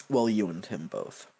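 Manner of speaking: ordinary talk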